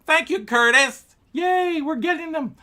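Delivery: high, loud delighted squeal; thrilled to greet a new subscriber, squealing high; excited. Pretend. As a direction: gush over the new subscriber. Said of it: "I'm gonna go".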